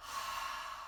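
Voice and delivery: slow audible exhale through the mouth; long breath out. Shown as a demonstration. Teacher to children, demonstrating a slow exhale. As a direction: show the kids a big breath out. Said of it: "we'll all breathe out".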